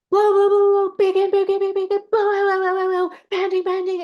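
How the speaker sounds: high-pitched, rapid-fire loud urging; loud animated coach. Retold or imitated. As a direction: imitate an over-animated breathing coach at full volume. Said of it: "loud, but, like".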